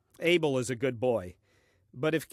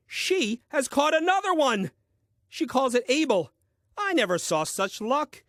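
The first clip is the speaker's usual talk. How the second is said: amazed; 8 semitones higher, 4 dB louder than usual. Acted. Adam marvels at Eve's new catch.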